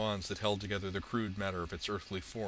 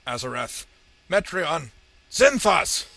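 louder and higher on each word; building incantation; 8 semitones higher, 14 dB louder, slower than usual. A narrator's reading. Sorceress unleashes power on the villain, incantation rising to a shout. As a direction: spell words climbing from raised voice to full shout.

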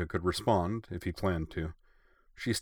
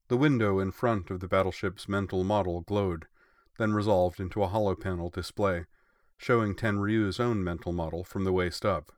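ordinary delivery

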